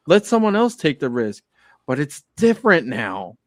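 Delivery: pleading Bruce Wayne. Retold or imitated. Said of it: "on his knees pleading to them".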